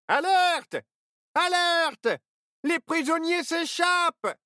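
high voiced elf guard raising the alarm; elf alarm call.

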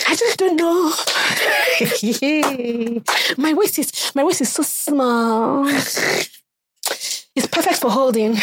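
coy humblebrag, breaking into laughs; mock coy boast. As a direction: fake modesty, giggling through it. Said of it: "this is one that women do a lot".

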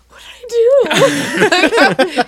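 high, drawn-out, hammed-up whine; slow, loud comic protest of innocence; mock wail. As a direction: wail innocently, playing the victim. Acts out being yelled at.

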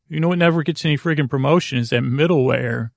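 normal talking voice

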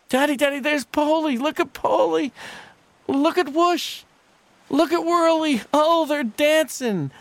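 put-on very high, loud child's delight; high awestruck child; excited little boy. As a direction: point out each friend with glee. Boy points out bears to father.

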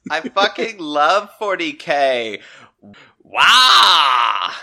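excited gushing. Acted. Stacy gushing about Warhammer.

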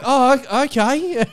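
high mock flustered voice; squeaky stammering okay; caller taken aback. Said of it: "And she's like".

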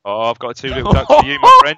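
booming bingo caller call-out. Pretend. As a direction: sing out the number like a bingo hall caller. Bingo call "two little ducks.

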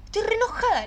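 high, teary confession; retelling a sob to the therapist, high and teary; tearful high voice. Retold or imitated. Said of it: "empecé a llorar".

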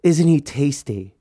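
slow, drawn-out swooning "Isn't he tasty?"; mock-dreamy sister gushing over her brother; imitating Farah admiring. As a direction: savour "tasty" like she's drooling over him. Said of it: "she goes".

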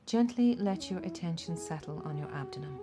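usual speaking voice

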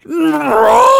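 high, loud shouted no; retold shout. Caller yelling at the agent who hung up, loud high shout. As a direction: yell it at the phone. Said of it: "And I'm like".

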